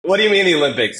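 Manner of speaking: high, loud, astonished question; incredulous. Retold or imitated. Coach reliving hearing his applicant went to the Olympics, incredulous. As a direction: blurt it out in retold disbelief.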